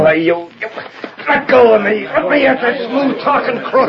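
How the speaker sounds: high, furious straining shout; angry struggle. Acted. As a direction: fight against the hands holding you. Blake struggling against captors.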